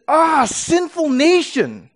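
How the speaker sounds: high, slow, anguished cry of "Ah, sinful nation"; exasperated prophet. Shown as a demonstration. God crying out at a sinful nation, exasperated. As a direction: grab them and shake them with it. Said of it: "frustrated, exasperated kind of scream".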